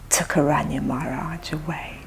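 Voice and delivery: hushed, unvoiced chastising whisper; whispered reproach. Guru scolds the disciple over his lost sannyasi, an angry whisper. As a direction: breathe the accusation with no voice. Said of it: "like this".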